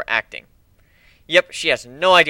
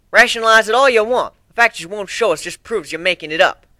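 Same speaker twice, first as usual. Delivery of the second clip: a touch of a scornful snap, higher and louder; spat; 5 semitones higher, 5 dB louder than usual. A narrator's reading. Applejack dismisses Griffin's excuses, mild scornful snap. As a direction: spit the words out at him. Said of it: "Applejack spat".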